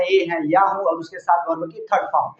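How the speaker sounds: ordinary talk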